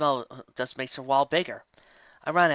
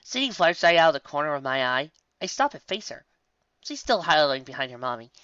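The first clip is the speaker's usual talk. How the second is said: ordinary delivery, nothing marked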